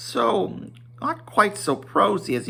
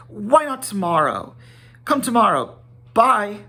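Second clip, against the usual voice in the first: high, louder, fussy hobbit brush-off; hurried Bilbo; 8 semitones higher, 3 dB louder than usual. Acted. Bilbo hastily inviting Gandalf to tea, high and hurried. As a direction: bundle the guest off quickly.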